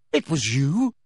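mild shocked question; a touch of breath-caught disbelief; gasped. A narrator's reading. Boy learns who attacked him, mild gasp. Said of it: "gasped Harry".

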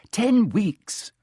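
sharp, high-pitched retort; snapping. Acted. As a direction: snap back impatiently. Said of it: "Hermione snapped".

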